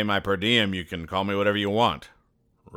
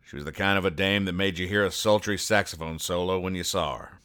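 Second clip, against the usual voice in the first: noir detective. Acted. Noir private eye narration.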